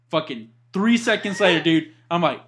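usual speaking voice

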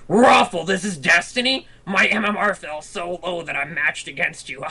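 high, loud voice of a gloating online troll; mock troll taunt.

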